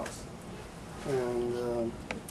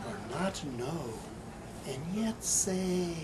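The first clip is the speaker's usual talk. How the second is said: quieting poem ending; 4 semitones higher, much slower than usual. Acted. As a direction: bring it back down to a reflective close.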